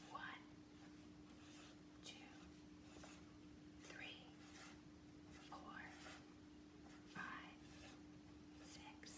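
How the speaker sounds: whispered counting. Shown as a demonstration. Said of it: "whisper count as you do it".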